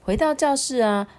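ordinary delivery

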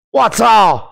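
loud, disgusted outburst; a real fed-up yell; exasperated shout. Real, not acted. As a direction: blurt it out in disbelief.

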